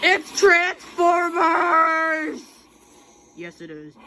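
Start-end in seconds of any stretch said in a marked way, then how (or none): 0.0-2.4 slow, drawn-out excited yell; excited shout. Real, not acted. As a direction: announce it big and slow. Excited announcement in game.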